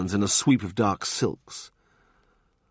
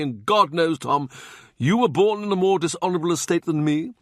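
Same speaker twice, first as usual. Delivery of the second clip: higher, chuckling lesson; amused Cardinal; 8 semitones higher than usual. Acted. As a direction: lecture with relish. Wolsey amused lecture.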